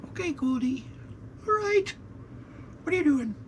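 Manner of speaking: high, sing-song voice for the cat. Real, not acted. Owner cooing at his cat Goldie, high and sweet. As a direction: coo up high at the cat like a pet. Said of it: "I talk to her in that high voice".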